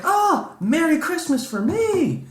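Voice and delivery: very high, gushing fake thrill; mock delight. Patient handed a tissue by the tester, mock delight. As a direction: squeal with hammed-up gratitude.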